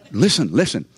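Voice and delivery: ordinary talk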